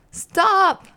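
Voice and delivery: frightened shout; panicked yell. Acted. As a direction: yell in panic as you spin. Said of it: "yelled Bert".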